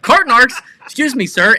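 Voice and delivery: loud, high announcement; mock officer callout. Cart vigilante accosting a shopper, loud mock callout. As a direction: announce yourself like a self-appointed cart cop. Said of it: "runs up to them and goes".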